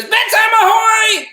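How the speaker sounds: very high, loud singsong holler; excited octopus. Acted. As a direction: belt it out like a gleeful ship's call. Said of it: "He's so excited".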